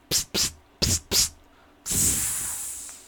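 short voiceless spurts of hissing; spider hiss. Retold or imitated. Daughter showing dad what spiders sound like, sharp hisses. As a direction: hiss in bursts like a web shooting. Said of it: "what a spider sounds like".

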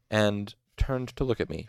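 plain reading voice

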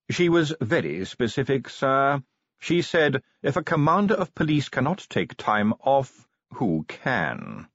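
formal butler. Acted. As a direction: read the news out like a proper manservant. Voices butler in story.

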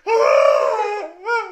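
high, loud laughing. Real, not acted. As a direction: laugh through the words. Laughing about dramatic breakup.

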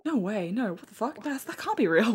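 soft, dumbfounded muttering to oneself; mock stunned disbelief. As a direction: imitate someone quietly struggling to process it. Acts out man's stunned thinking.

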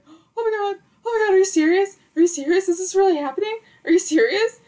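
high, loud, breathless disbelief; panicking. Retold or imitated. Girlfriend reacting to the proposal, panicking. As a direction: retell it as a high-pitched, overwhelmed outburst.

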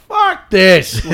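retold defiant outburst. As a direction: re-enact a loud defiant curse.

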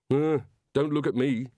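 mumbled. A narrator's reading. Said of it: "he mumbled".

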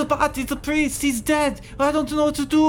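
very high, loud frantic chicken; panicked chicken. Acted. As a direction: wail in helpless panic. Panicked chicken over dead priest.

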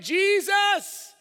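blind beggar yelling for mercy; high, full-force cry to be heard; shouting. Acted. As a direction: shout desperately over a crowd. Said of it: "it says that he shouted".

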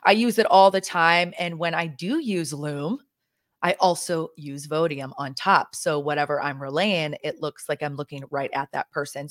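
ordinary delivery

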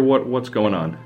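usual speaking voice

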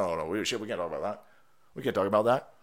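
low, hushed pretend cover-up; mock hush-hush. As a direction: drop way down as if the topic were forbidden. Mimics hushing up a taboo topic.

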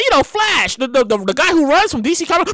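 ordinary delivery

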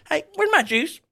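high hillbilly call; high West Virginia voice; mock Jimbo Fisher. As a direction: squeak it like a hillbilly coach. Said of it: "he's like".

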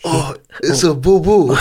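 high-pitched mock Japanese accent. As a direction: put on an exaggerated Japanese accent.

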